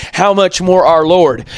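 ordinary delivery

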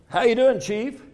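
booming friendly hello; hearty greeting. Retold or imitated. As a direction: hail him cheerfully from a distance. Calling out a greeting.

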